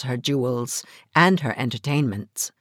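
usual speaking voice

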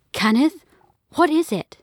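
mom's worried question. Acted. Frightened mom questions dad.

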